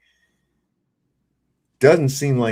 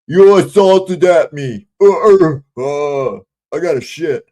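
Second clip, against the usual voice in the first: higher, louder, slow mocking whine; mocking the woman; 5 semitones higher, 7 dB louder, slower than usual. Man mocking the woman in the video, slow and loud. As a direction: parrot her back slowly, pitched up and loud.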